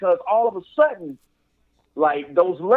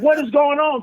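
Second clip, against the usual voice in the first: higher-pitched confused question; mock bewilderment; 8 semitones higher than usual. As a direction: play the baffled wrestler lightly. Voicing Finn's bewildered reaction.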